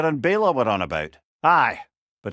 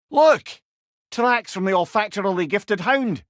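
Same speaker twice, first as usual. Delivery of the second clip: excited call; 5 semitones higher than usual. Real, not acted. Calling to companions on spotting tracks, excited shout. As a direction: shout out to grab attention.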